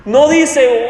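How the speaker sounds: normal talking voice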